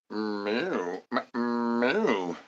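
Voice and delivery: soft, drawn-out kitty meows; a purring cat's soft meow. Pretend. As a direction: mew quietly like a little cat. Cat noises between chant lines.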